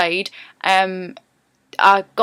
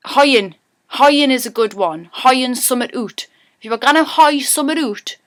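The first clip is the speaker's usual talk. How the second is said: emphatic Geordie demo, pitched up; broad Geordie; 4 semitones higher, 5 dB louder than usual. Shown as a demonstration. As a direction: hit the Geordie vowels hard, higher voice. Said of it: "learn a Geordie accent".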